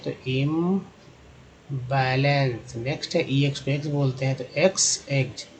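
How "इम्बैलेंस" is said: इम्बैलेंस drawn out, इम first; इम्बैलेंस slow and careful; slow clear word. Shown as a demonstration. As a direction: spell out इम्बैलेंस in sound. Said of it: "आईएम को इम बोलते हैं".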